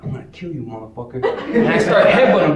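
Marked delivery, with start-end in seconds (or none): 0.0-1.2 low, very soft acted menace; quiet death threat. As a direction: drop to a hushed, deadly undertone. Acted threat atop brother.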